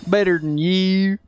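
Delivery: slow, stretched Texas drawl on "you"; showy, slowed-down Texas accent; Texas country accent. Shown as a demonstration. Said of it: "I do that accent better".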